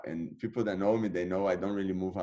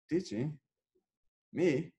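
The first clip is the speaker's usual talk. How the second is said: surprised disbelief; 8 semitones higher, slower than usual. Retold or imitated. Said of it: "I'm like".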